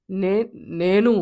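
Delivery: slow, loud, dragged-out fake mother voice; a fox badly faking a mother's voice; fox's put-on voice. Fox at the door drags out a loud, slow fake mother voice. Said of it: "ఆ గొంతు వినంగానే".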